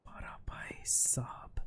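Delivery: slow, breathed-out amazement; hushed, drawn-out disbelief; awed whisper. Real, not acted. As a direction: whisper it slowly, as if impressed. Awed reaction to player's score.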